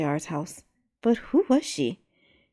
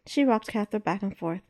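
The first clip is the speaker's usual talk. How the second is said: ordinary delivery, nothing marked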